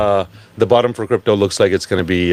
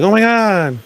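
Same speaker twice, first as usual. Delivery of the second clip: mock gasp; 12 semitones higher, 3 dB louder than usual. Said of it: "And that's like".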